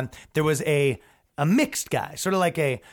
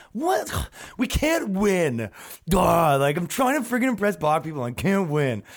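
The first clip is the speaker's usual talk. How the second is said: whiny protester; 3 semitones higher than usual. Retold or imitated. Protester whining he can't impress anyone, mock whine. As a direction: whine like a deflated activist.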